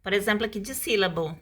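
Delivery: ordinary talk, nothing marked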